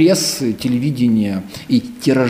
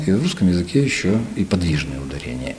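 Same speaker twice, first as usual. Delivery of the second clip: ordinary talk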